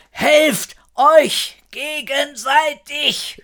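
high, drawn-out distant call; calling from afar. A narrator's reading. Frau Horatio calls to fleeing children from far off, high drawn-out call. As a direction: call out slowly, word by word, pitched high. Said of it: "Frau Horatio rufen, immer leiser werdend".

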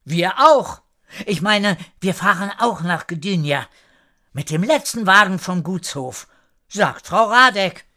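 high, eager character voice; lifted pitch with halting self-correction; calling out. A narrator's reading. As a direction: blurt out the news across the yard. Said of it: "auch etwas zu rufen".

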